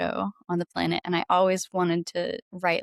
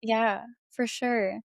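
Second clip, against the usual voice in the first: ordinary delivery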